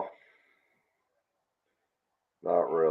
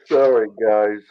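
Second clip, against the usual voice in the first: talking through a yawn; 5 semitones higher, 9 dB louder than usual. Real, not acted. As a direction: yawn while you say it. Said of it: "I'm yawning".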